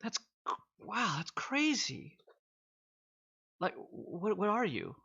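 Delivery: high-pitched, halting disbelief; dumbfounded, tripping over the words; astonished. Acted. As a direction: stammer in awe, voice lifted high. Game master acts amazed smith.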